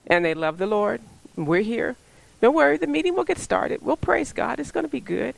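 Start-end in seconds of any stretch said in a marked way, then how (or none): 1.3-5.3 high, breezy reassurance; pitched up, easygoing and quick; carefree. Retold or imitated. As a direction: imitate laid-back, untroubled people. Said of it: "the people are just kinda carefree".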